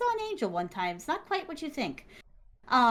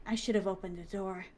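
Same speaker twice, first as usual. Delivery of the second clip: quiet regret; 4 semitones lower, 6 dB quieter than usual. Real, not acted. Regret over the headless man.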